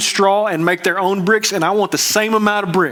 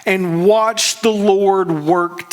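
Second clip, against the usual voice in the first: slow firm command; much slower than usual. Retold or imitated. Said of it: "He says".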